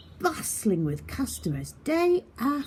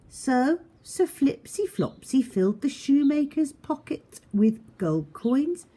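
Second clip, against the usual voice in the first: ordinary delivery, nothing marked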